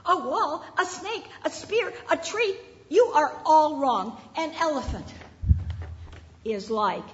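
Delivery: shouting. Acted. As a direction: yell it at the others as if they're all fools. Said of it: "the fifth man started to shout".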